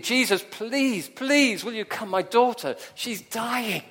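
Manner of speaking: high, slow, loud desperate begging; a father begging for his dying child; desperate pleading. Acted. Father at Jesus' feet begging him to come, desperate plea.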